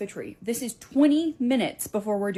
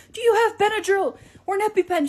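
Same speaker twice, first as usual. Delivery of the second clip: higher, louder urgent asking; urgent plea; 12 semitones higher, 8 dB louder than usual. Retold or imitated. Teacher urgently asking the shopkeeper for medicine. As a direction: beg the shopkeeper for help.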